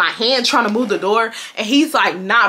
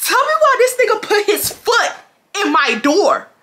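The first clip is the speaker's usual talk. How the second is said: shocked; 10 semitones higher than usual. Retold or imitated. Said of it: "Bitch, I said".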